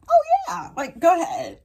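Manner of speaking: high fake-breezy okay; forced casual. To her teacher-doctor, faking calm in a high voice. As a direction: pretend it's fine, voice up high.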